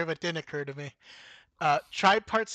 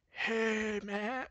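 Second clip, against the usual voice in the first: mock surfer voice; 5 semitones higher, 4 dB quieter than usual. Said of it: "doing mock surfer voices".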